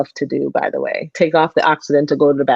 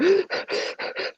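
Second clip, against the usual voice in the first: sharp unvoiced gasp, pitched high; a panicking patient gasping for air; panicked gasp; 14 semitones higher, 8 dB quieter than usual. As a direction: imitate a hyperventilating patient's gasp. Imitating hyperventilation.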